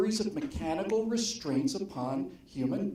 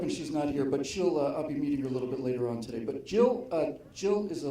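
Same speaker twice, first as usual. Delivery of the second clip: ordinary delivery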